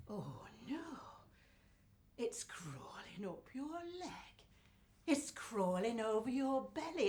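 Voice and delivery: starts whispered, builds to loud laughing taunt; creepy tease. Acted. Father scaring Betsy, from whisper to loud laugh. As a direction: creep it up from hush to cackling.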